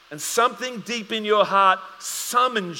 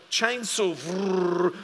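chainsaw buzz; much slower than usual. Retold or imitated. Imitating a chainsaw.

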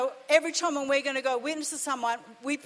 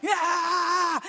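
mock yell; 3 semitones higher than usual. Retold aggressor yelling.

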